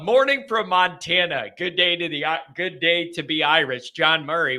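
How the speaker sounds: normal talking voice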